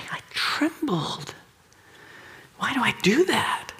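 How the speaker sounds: unvoiced whisper, baffled at himself; whispered to a brother elder, ashamed; whispered confession. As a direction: hush it like a shameful secret.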